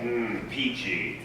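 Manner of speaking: slow, soft, dry sarcasm; deadpan, unimpressed; sarcastic. Acted. Dry response to good news.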